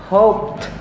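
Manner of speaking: "Hoped" ending in a crisp t, /opt/. Shown as a demonstration. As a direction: clip "Hoped" off with a t. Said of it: "Pag binigkas na, dinagdagan ng D".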